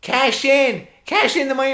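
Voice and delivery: very high, loud heckle; nerd fan's shout. Retold or imitated. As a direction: yell at the wrestlers like an overexcited fan. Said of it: "he would just be like".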